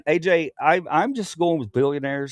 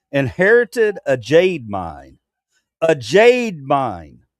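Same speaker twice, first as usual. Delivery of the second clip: "jade mine" drawn out slowly and louder; slow emphasis; 5 dB louder, much slower than usual. Real, not acted. Hammering point on Musk.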